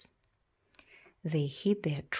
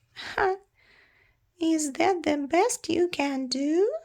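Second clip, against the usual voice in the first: high, louder taunt; mocking; 10 semitones higher, 5 dB louder than usual. Creature taunting the baby dragon, high mocking. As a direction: scoff at the weak sparks.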